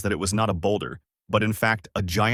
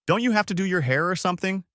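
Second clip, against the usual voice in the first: mocking teasing question, pitched higher; sarcastic; 9 semitones higher, faster than usual. A narrator's reading. Goku teases Bulma sarcastically, higher mocking lilt. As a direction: needle her with a sneer. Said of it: "asks sarcastically".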